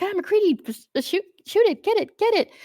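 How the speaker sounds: high-pitched mock panic; imitated freak-out. Film crew urging MacReady to shoot the creature, mock-panicked. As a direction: squeal it like a panicking crew member. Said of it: "freaking out".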